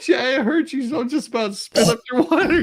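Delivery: high, giggling words through laughter; laughing hard. Real, not acted. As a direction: speak while cracking up. Laughing about witness choking.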